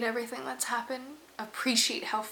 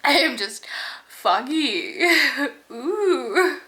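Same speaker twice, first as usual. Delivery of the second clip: louder laughing through tears; real happy tears and laughter; teary laughter; 3 semitones higher, 15 dB louder, much slower than usual. Real, not acted. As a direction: laugh it out while crying.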